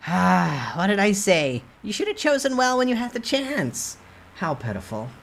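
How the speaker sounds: smug high sprite scolding the hero; gloating merchant. Acted. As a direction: rub it in like a smug little merchant.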